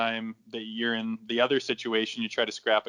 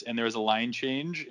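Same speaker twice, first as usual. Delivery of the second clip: ordinary talk, nothing marked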